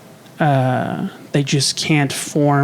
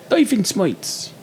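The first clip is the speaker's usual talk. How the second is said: Doofenshmirtz in a Yiddish accent; 4 semitones higher, slower than usual. Shown as a demonstration. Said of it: "but with a Yiddish accent".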